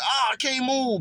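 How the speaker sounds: high, startled cry of someone frozen in sleep; a hint of sleep-paralysis fright; mock panic. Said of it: "you be like".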